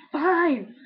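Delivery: loud, high exasperated outburst; imitated fed-up shout, not full force; retold yell. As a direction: re-enact yelling it in frustration. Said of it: "yelled at her like that".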